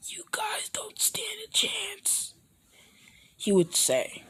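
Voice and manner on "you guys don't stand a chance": slow, menacing whisper; hushed slow warning to the group; whispered threat. Acted. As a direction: breathe the threat out slowly.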